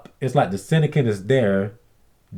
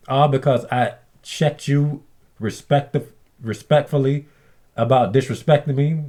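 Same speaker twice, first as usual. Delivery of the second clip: usual speaking voice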